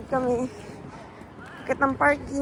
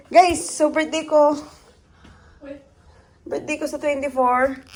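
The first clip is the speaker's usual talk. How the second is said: ordinary talk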